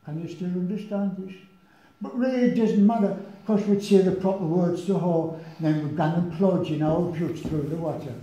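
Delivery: a lad from Wallsend reading his own verse in Geordie; Geordie accent poem. Retold or imitated. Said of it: "I'll try and give it to you in his accent".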